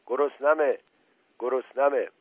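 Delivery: narrator's restrained roar; mock roar. A narrator's reading. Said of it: "نعره کشید".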